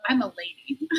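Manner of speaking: hushed, indignant mock propriety; soft, scandalized put-on dignity; mock offended lady. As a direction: play the affronted lady, very softly.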